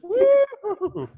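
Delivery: high, loud "woo"; celebratory party whoop. Acted. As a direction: whoop while running down a cheering line. Whooping down a Soul Train line.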